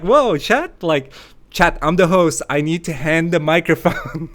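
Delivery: loud, high mock outburst at chat, laughing; hammed-up host demanding the mic; mock bossy host. Host mocking herself scolding the chat, loud and laughing.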